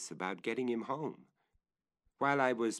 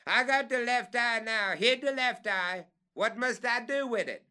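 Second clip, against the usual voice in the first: much higher, louder report; calling down; 14 semitones higher, 6 dB louder than usual. Acted. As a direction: ask what next from up high.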